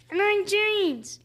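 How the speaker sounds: high squeaky puppet voice; squeaky ventriloquist dummy. Acted. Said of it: "his ventriloquist dummy, James".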